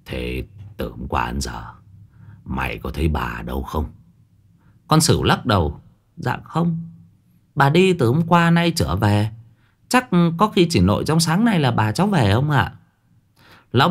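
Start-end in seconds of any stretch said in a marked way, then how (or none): 0.1-3.8 put-on low, heavy old man's voice; an old landowner questioning his servant; gruff old master. Old master asks the servant girl, in a low gruff voice. 6.2-12.7 put-on high, deferential girl's voice; young servant girl. Servant girl answers her master, high and polite.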